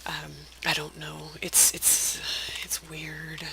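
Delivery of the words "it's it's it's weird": unvoiced confiding whisper. Real, not acted. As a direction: drop to a whisper. Whispered aside.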